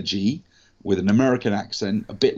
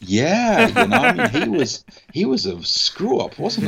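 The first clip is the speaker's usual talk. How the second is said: laughing; 12 semitones higher, 4 dB louder than usual. Real, not acted. Laughs over Downey Jr remark.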